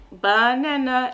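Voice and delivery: very slow, drawn-out label; very slow, clear repeat of the word; slow modeling. Shown as a demonstration. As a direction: stretch the word out slowly for the child. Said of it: "you can peel it and be like".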